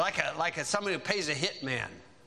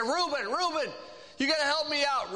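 higher, pleading cry for help from the pit; desperate plea; 9 semitones higher than usual. Retold or imitated. Joseph in the pit begging his brother Reuben, higher desperate plea. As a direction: beg your brother like a scared kid.

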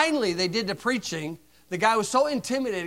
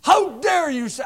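retold outraged yell; 8 semitones higher, 8 dB louder than usual. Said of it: "He said".

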